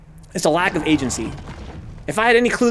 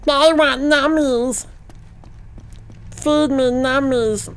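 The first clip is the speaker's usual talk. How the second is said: put-on higher, slow babyish whine; baby creature voice; 7 semitones higher, much slower than usual. The creature begging the player for food, babyish. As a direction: plead like a hungry little creature. Voicing creature he can feed.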